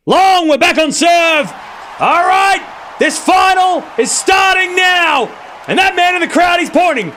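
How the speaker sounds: high, loud, excited yelling; real excitement boiling over, high and loud; excited shout. Real, not acted. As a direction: shout it out as the point is lost. Point lost, crowd goes wild.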